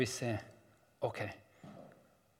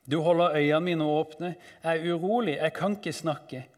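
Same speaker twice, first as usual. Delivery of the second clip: raised, urgent lament; dramatic psalm reading; 4 semitones higher, 6 dB louder than usual. Acted. Psalmist seeking God in need, urgent lament. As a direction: declaim it in distress.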